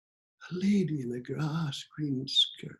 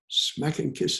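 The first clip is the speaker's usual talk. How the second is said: ordinary delivery, nothing marked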